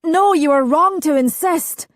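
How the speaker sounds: high, loud protest; desperate. Acted. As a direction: protest in panic.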